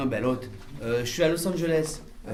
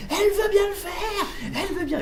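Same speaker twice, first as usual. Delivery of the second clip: excited; 3 dB quieter than usual. Retold or imitated. Thrilled news to his production.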